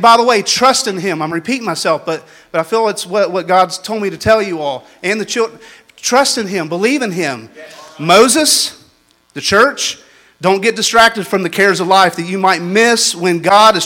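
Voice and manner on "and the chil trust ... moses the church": ordinary talk, nothing marked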